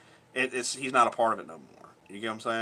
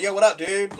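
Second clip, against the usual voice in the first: lively retold "yo" hail, higher and louder; called-out greeting; 6 semitones higher, 5 dB louder than usual. Greeting a guy he didn't know, called out. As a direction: imitate calling out to some dude.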